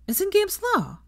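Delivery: a schoolgirl correcting a classmate, high pitched; student voice. Acted. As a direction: chime in high and doubtful. Girl beside him interjects.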